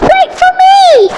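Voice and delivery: high loud call. Acted. The frog calling after Poppy, high and loud. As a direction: call after her desperately.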